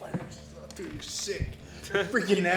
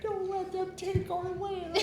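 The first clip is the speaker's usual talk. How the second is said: funny character voice. Pretend. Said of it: "lazy British voice, German authoritarian voice, or Carl Weezer".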